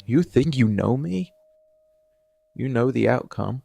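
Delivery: usual speaking voice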